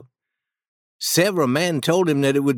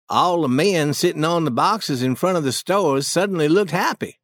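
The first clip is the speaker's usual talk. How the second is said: ordinary delivery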